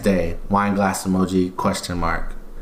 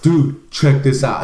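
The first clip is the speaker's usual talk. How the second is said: put-on a light American bro accent, a bit louder and slower; 2 semitones higher, 5 dB louder, slower than usual. Coworker showing a twerking video, light American accent. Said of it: "I'm gonna speak in American accent".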